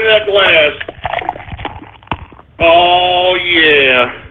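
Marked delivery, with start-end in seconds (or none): none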